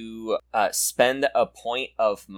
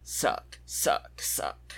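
whispered sucking; 3 semitones higher, 4 dB quieter, much slower than usual. Shown as a demonstration. Acting out sucking versus blowing.

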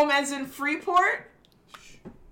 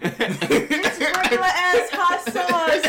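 laughing; 7 semitones higher, 6 dB louder, much slower than usual. Real, not acted. Laughing protest.

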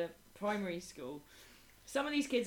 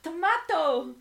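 much higher, loud cheerful cry; proud child shout; 11 semitones higher, 14 dB louder, much slower than usual. Retold or imitated. Little boy proudly shouting his only answer.

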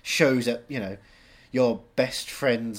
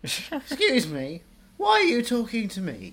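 put-on high, slow prim old lady voice; Maggie Smith impression; 11 semitones higher, 5 dB louder, slower than usual. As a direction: play a fussy elderly dame, stretched out. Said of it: "Maggie Smith popped out".

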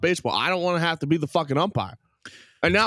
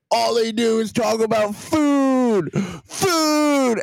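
high, slow whiny mimicry of Twitter complainers; mocking complainers; 8 semitones higher, much slower than usual. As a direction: drag out the food in a mocking whine. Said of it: "They're like".

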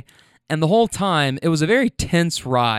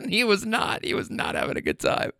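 breathless, quieter words after cracking up; talking through laughter; 5 semitones higher, 7 dB quieter than usual. Real, not acted. Teller cracking up over the unhappy husband, laughing as he talks. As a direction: squeeze the words out while laughing.

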